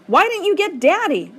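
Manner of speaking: high, loud accusing blurt. A narrator's reading. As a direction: fire the question at Mama. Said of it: "I blurt out".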